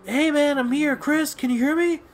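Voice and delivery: high, far-off voice of a friend on the headset; friend over bad chat. Friend on the headset calling to Chris, high and distant. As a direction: call out through a crackly game chat, high and pushed. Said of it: "I kept hearing one of them say".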